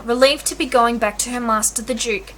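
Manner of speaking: plain reading voice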